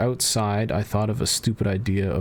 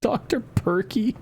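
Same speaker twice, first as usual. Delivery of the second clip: amused. Real, not acted. Reading funny brand names.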